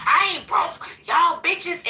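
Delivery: loud, high, offended snap back; defensive angry outburst. Retold or imitated. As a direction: yell it like he's personally insulted. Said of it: "and be like".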